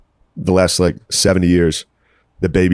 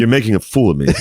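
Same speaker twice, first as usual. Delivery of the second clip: normal talking voice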